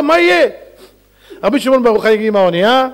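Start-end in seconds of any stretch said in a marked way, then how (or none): 0.0-0.5 high, hammed-up lament; mock weeping. King despairing over his possessed daughter, high mock weeping. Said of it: "והמלך בוכה".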